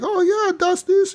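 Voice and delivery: squeaky high mock German babble. Debtor babbling German at Arthur, squeaky high mock accent. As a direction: ham up a German gibberish in a very high voice. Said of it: "he's like".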